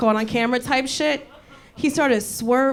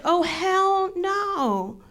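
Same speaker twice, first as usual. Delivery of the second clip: drawn-out, higher-pitched refusal; indignant refusal; 5 semitones higher, much slower than usual. Retold or imitated. Telling how the truck cut us off, indignant. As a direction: stretch it out in disbelief.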